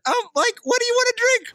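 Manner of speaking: put-on very high, fast valley-girl squeak; ditzy airhead squeal, hammed up; mock waitress voice. Retelling the date to friends, squeaky mock girl voice. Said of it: "She said".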